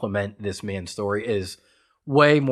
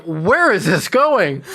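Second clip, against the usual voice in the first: high, loud and slow, drawn out in alarm; stunned parent watching with child, high slow alarm; alarmed disbelief; 7 semitones higher, 7 dB louder, slower than usual. Retold or imitated. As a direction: ask it stretched out and worried. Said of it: "I'm like".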